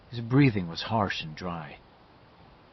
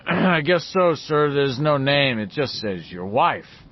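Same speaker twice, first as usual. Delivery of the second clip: raised, louder voice through a door; posing as bellboy; 3 semitones higher, 5 dB louder, slower than usual. A narrator's reading. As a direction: answer up like hotel staff, slowing at the end.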